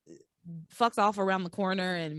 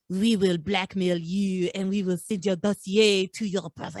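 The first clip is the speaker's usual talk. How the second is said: lightly put-on French accent; a hint of a mock French villain accent; 2 semitones lower than usual. Podcaster voicing Pierre in a mock French accent. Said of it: "my French accent".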